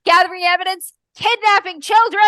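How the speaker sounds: high, loud sarcastic echo; mocking crowd. Villager mocks the constable's excuse, high and sarcastic. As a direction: throw his words back at him, mocking.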